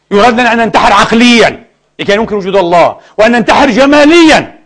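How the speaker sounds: a preacher's climbing rhetorical list; fiery preaching. Acted. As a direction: hammer it home from the pulpit. Preacher building to full force.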